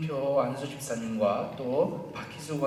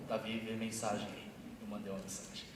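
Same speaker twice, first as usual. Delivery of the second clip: ordinary talk, nothing marked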